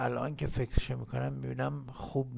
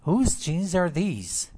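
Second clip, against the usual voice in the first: slow and loud, stressing Whose; teacher modelling; 5 semitones higher, 6 dB louder, much slower than usual. Shown as a demonstration. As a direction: model each word slowly for the class. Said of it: "لطفاً تکرار کنید".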